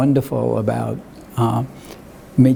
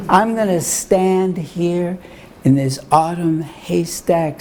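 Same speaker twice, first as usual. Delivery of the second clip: unhurried poem reading with lifted lines; poetry reading; 3 semitones higher than usual. Acted. Poet reads his poem to the gallery crowd, lyrical reading. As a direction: let each image land.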